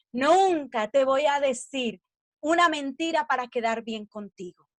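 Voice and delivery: ordinary talk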